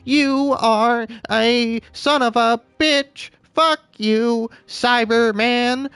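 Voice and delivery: shrill alien robot ranting; Dalek voice. Acted. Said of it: "sound like a damn Dalek".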